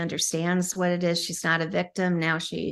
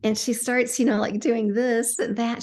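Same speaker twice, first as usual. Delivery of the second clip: ordinary talk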